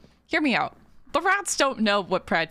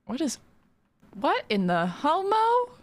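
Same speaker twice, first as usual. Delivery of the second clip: much higher, slow stunned question; surprised; 7 semitones higher than usual. Real, not acted. Streamer gapes at a friend's strange build, stunned and high. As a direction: react in disbelief. Shocked at Scott's farm.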